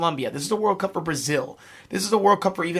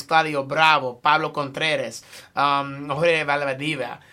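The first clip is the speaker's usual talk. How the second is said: Spanish accent; slower than usual. Real, not acted. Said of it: "sorry, my Spanish accent".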